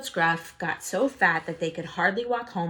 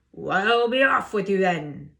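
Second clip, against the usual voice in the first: loud troll dismissal; 6 dB louder than usual. Acted. Troll waving the little goat away, loud. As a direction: send him off gruffly.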